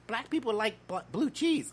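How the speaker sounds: hammed-up high-pitched coworker impression; mock coworker voice. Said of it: "She's like".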